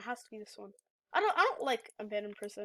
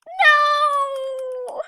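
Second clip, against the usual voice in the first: long, high, loud wail of no; real howl of defeat; anguished shout; 13 semitones higher, 15 dB louder than usual. Real, not acted. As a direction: cry out in despair. Caught again in game.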